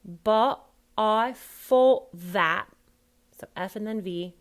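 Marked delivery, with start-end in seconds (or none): 0.0-2.7 word by word "fought that" as fough' va'; loud, slow, t dropped from FOUGHT and THAT; no final T's. Shown as a demonstration. Coach drilling the actor, no final T's. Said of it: "remember, no final T's".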